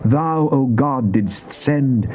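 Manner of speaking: ordinary talk, nothing marked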